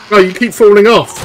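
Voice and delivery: ordinary delivery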